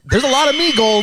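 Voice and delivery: high, shouted leprechaun. Acted. Cohost riffs on the movie in a shouted leprechaun voice. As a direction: cry it out like a greedy leprechaun.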